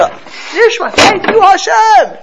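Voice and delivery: high, loud cry of thanks; full-voiced ecstatic thank you. Shown as a demonstration. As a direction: burst out with gratitude. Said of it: "we feel an urge to".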